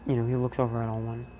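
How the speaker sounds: ordinary delivery, nothing marked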